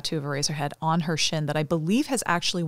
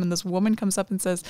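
ordinary delivery

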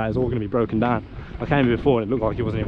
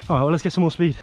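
usual speaking voice

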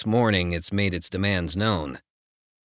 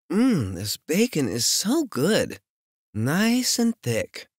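slow, higher, savouring tease; teasing heroine voice; 11 semitones higher, slower than usual. Acted. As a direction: draw it out slowly to provoke him.

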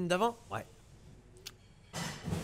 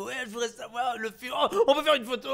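high, rising and louder toward the photo request; excited fan; 9 semitones higher, 5 dB louder than usual. Acted. Starstruck fan asking the actor for a photo, high and loud.

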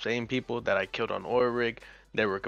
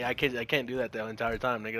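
ordinary talk, nothing marked

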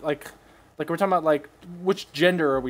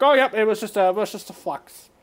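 mock excuse voice; 6 semitones higher, 3 dB louder than usual. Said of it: "he'll fucking, like".

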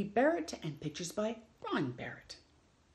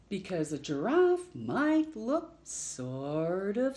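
lively picture-book read-aloud with long pauses; reading to children; slower than usual. Acted. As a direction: read it like story time for little ones.

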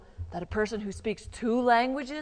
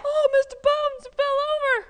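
mock alarm; 10 dB louder than usual. Teacher reacts to puppet falling over, mock alarm. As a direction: exclaim in playful shock.

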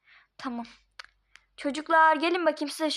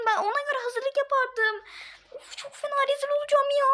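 panicked whining; 10 semitones higher than usual. Acted. Panicking, near tears.

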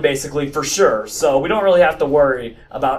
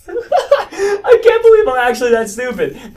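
real laugh breaking through the words; laughing at himself; 13 semitones higher, 4 dB louder, slower than usual. Real, not acted. Gamer laughing at his own blunder, laughing through it. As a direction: laugh it out, higher and slowed down.

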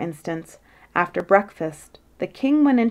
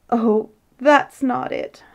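acted sob, higher and slow; sobbed; 6 semitones higher, slower than usual. A narrator's reading. Queen correcting the king, mock-tearful sobbing. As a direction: wail it slowly, as if tearful. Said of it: "sobbed Her Majesty".

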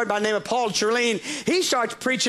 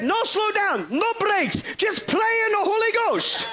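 high, shouted carefree driver; wild driver; 8 semitones higher than usual. Retold or imitated. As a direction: belt it out like a reckless driver. Driver's wild reply in story.

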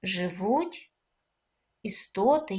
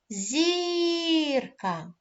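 "зірка" called with a long, raised first syllable; calling out; 7 semitones higher than usual. Shown as a demonstration. Teacher calling with pupils, "зірка" stretched on the first syllable. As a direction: hold "зір" high, then drop to "ка" in "зірка". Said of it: "Гукаємо".

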